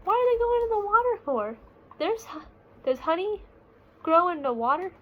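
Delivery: put-on high, quick questioning child voice; puzzled little cub. Small bear asks his dad why they go in the water, high and puzzled. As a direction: rush the questions like a curious kid.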